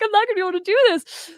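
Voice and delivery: high, fast, teary wail; retold crying despair. Crying hopelessly to Joey at night. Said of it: "I'm crying every night to Joey".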